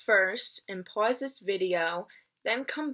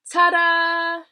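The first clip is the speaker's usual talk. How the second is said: loud high ta-da; triumphant cry; 10 semitones higher, 13 dB louder than usual. Acted. Big U proudly showing the freed wish, loud and high. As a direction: announce the win with a flourish. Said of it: "she cried".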